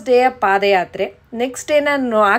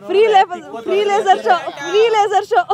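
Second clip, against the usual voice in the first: high, excited call-out; excited announcing; 7 semitones higher than usual. Real, not acted. Vlogger announcing a laser show to viewers, excited.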